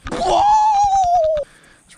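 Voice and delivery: slow excited shout. Real, not acted. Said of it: "I just get too excited sometimes".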